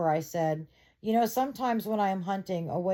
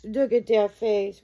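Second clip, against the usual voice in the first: doting; 2 semitones higher, 7 dB louder than usual. Real, not acted. Doting on dog.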